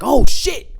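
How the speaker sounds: high, loud retold outburst of amazement; excited exclamation. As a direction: blurt it out at the big dunk. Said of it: "And you was like".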